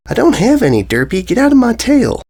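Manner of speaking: high, annoyed snap; irritated filly. Acted. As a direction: shoo her away. Filly snaps at Derpy.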